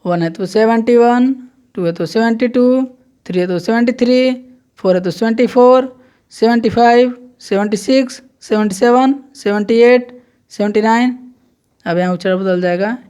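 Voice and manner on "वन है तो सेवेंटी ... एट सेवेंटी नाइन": each number called out a little higher and louder; slow counting drill. Shown as a demonstration. Teacher counting seventies aloud for students, emphatic drill. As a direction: recite clearly for the class to repeat. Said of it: "तो देखिए".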